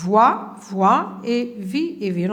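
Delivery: slow, each syllable apart; slow dictation. Shown as a demonstration. Teacher dictating syllables for pupils to write, slowly. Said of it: "كتبوها من هنا".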